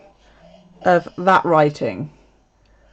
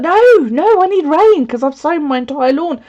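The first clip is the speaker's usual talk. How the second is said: high, loud retold protest; retelling her protest against the sun coming out; mock protest; 7 semitones higher, 4 dB louder than usual. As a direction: push back in mock alarm. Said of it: "I was like".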